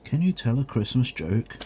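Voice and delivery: normal talking voice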